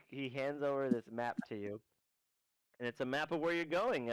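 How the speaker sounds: ordinary delivery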